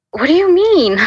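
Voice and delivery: high, exasperated protest. Retold or imitated. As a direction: throw the question back, pitch leaping up. Retold exasperated reply to relentless voice.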